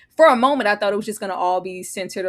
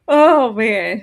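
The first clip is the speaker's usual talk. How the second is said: teary; 3 semitones higher, 8 dB louder than usual. Real, not acted. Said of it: "I'm crying".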